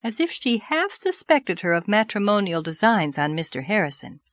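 ordinary delivery

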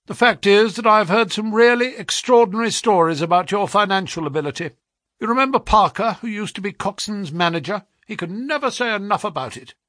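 butter him up briskly; flattering brisk agent. A narrator's reading. Agent flatters the clerk's talent, eager and brisk.

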